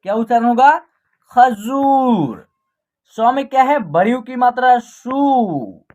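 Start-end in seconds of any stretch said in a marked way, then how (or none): 1.3-2.5 खजूर with a very long जू; long vowel. Shown as a demonstration. Teacher answering what the pronunciation is, खजूर drawn out. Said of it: "आप long तरीके से उच्चारण". 4.8-5.8 सू with a long-held ऊ; long vowel. Shown as a demonstration. Teacher showing स with the matra, सू drawn out. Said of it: "आप long तरीके से उच्चारण".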